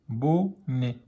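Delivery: "bonnet" slowly split as bo-nnet; syllable by syllable. Shown as a demonstration. Teacher decoding for beginning readers, slow syllable by syllable. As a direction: pause between the two syllables of "bonnet".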